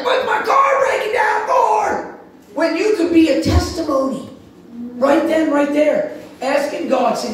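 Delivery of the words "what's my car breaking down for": high, slow exasperated yell; hammed-up complaint about the car; mock frustrated shout. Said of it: "we're like".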